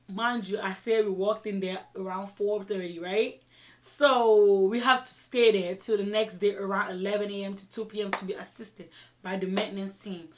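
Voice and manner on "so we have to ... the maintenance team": usual speaking voice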